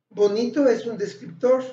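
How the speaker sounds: ordinary talk, nothing marked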